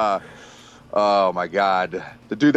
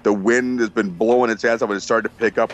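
ordinary delivery

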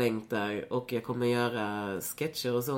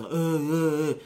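mocking noises; 4 semitones higher, 6 dB louder, much slower than usual. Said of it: "börjar typ hålla på så".

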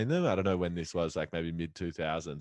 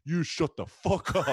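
loud, higher-pitched telling-off; mock yell; 8 semitones higher, 5 dB louder than usual. Feuding brothers turn together on the interviewer, mock yell. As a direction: burst out at the meddling interviewer.

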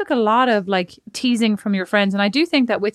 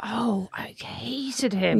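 whispered; 3 semitones lower, 7 dB quieter, much slower than usual. Real, not acted. Recalling disliked ex.

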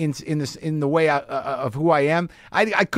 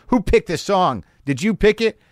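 demanding; 9 semitones higher, 3 dB louder than usual. Retold or imitated. Drunk guy confronts the jukebox picker.

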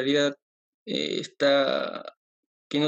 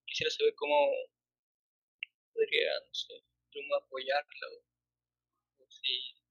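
far from mic; 4 dB quieter, slower than usual. Real, not acted. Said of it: "acércate al micrófono, no te escucho".

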